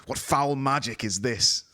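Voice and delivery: envoy horrified by the disappearance; alarmed. A narrator's reading. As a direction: gasp it out in horror. Envoy alarmed at vanished companion.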